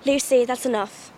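slow, measured English accent. Shown as a demonstration. Quoting Narnia for a friend, English accent. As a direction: scold gently in an English accent. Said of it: "I like the English accents from that one".

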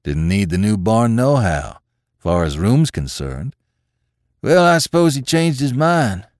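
put-on country farmhand's rustic accent; rustic hired man, conceding; thick rustic tones. A narrator's reading. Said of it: "in his thick, rustic tones".

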